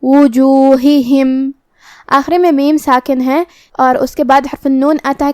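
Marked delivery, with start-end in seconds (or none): 0.0-1.5 وجوہہم said very slowly, both ہ sounded clearly with zer; tajweed demo. Shown as a demonstration. Teacher showing students how to say وجوہہم, slow and careful. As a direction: stretch وجوہہم out and make each ہ heard. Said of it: "ہم یہ دونوں ہا کو ظاہر کریں گے".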